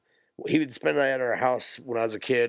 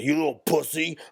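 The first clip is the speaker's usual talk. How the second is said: louder, higher sneer as his father; mock taunting dad; 6 semitones higher, 4 dB louder than usual. Father taunting his son after pushing him off the dirt bike, mock sneer. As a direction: jeer at the boy like the rough father. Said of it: "He's like".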